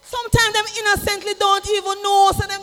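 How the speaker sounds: loud, high shouted rebuke; preacher shouting a rebuke; fiery preaching. Acted. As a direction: hammer the point home to the church.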